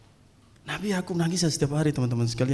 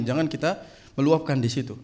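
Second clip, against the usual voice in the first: ordinary delivery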